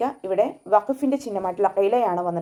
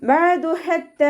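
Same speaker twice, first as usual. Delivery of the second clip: high, slow chanted verse; recitation demo; 9 semitones higher, 5 dB louder, much slower than usual. Shown as a demonstration.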